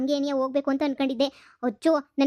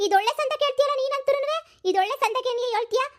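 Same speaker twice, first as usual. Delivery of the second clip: much higher, sneering indignant voice; mocking indignation; 9 semitones higher than usual. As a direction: scoff at him, pitch way up. Wife mocks husband in quarrel.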